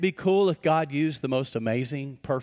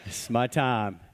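laughing, softer and lower; amused delight; 5 semitones lower, 4 dB quieter than usual. Retold or imitated. Pastor answers grumpy man with a laughing welcome. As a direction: chuckle like you've been handed a gift. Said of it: "And I went".

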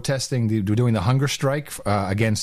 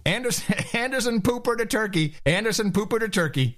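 high, silly paging voice; mock dispatch call; 8 semitones higher than usual. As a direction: repeat it as a comic high-pitched summons. Acted dispatch of CNN reporter.

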